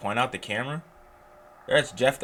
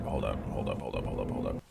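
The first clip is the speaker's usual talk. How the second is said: much lower, softer repeat; muttered hold up; 12 semitones lower, 5 dB quieter than usual. Real, not acted. Player muttering to himself in a low murmur. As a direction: drop it low under your breath.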